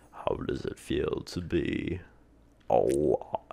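slow, theatrical character voice; mysterious figure. Acted. Creator asks how it feels to live, theatrical. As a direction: draw it out with grand pauses.

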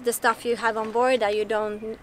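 ordinary talk, nothing marked

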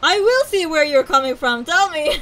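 loud, high-pitched demand; indignant retort. Pretend. Streamer butting in on characters discussing the captain, loud and indignant. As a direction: butt in loudly, pitch up. Talking back to game characters.

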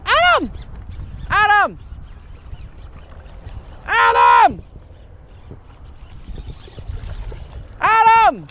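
very high, very loud shout across water; calling out. Real, not acted. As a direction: yell a name to someone far off. Calling friend from far off.

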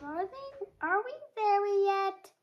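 high-pitched little goat whine; high, impatient young goat; little goat asking. A narrator's reading. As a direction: pipe up like an impatient kid goat.

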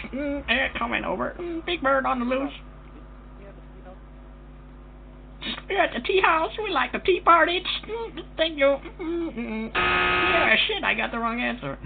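squeaky put-on prank caller voice; high silly voice. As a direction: pitch way up like a cartoon bird.

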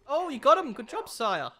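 ordinary delivery

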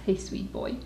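whispered aside to the puppy; whispering to dog. Real, not acted. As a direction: breathe it softly to the dog.

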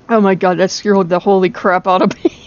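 ordinary talk

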